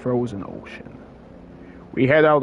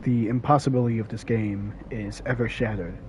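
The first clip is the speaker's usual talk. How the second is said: ordinary talk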